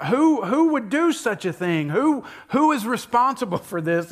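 high, loud outraged demand; furious king. Retold or imitated.

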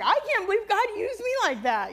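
high, loud amazed exclamation. Retold or imitated. Recalling her awe after praying with the widow. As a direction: gush in wonder at being used.

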